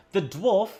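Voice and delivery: ordinary talk, nothing marked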